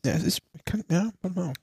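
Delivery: talking around a gummy; mumbling with candy in the mouth; sucking candy. Real, not acted. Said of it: "Ich lutsch gerade noch".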